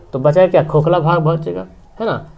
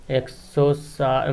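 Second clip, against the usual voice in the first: slow, softer, spaced-out words; dictating while writing; 3 semitones lower, 6 dB quieter, much slower than usual. Real, not acted. Teacher writes down the first area, dictating slowly. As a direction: say it word by word as you write.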